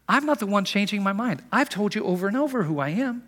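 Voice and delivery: high, insistent protest; lightly exasperated, repeating a point to doubters; acted exasperation. Jesus voiced answering the challenge.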